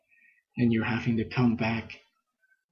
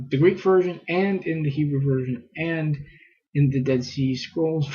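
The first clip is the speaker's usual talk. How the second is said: ordinary talk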